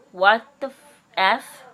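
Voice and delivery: outraged outburst. Retold or imitated. To the lying neighbor, a loud choked-off curse. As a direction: cut yourself off mid-swear in disbelief.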